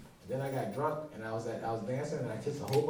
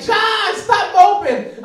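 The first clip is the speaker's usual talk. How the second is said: high, very loud, drawn-out mock scolding; imitating ex's yell; 18 dB louder, much slower than usual. Ex calling out to moping comedian, loud imitated scolding. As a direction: holler it like an exasperated ex. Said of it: "And she was like".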